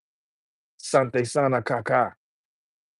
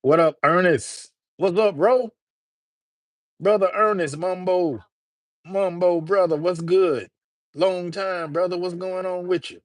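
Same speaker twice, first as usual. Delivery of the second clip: excited greetings; 6 semitones higher than usual. Real, not acted. Greeting chat friends loudly.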